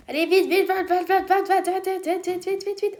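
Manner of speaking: frantic chant of "vite"; urgent hurry. Real, not acted. Rushing to grab the item.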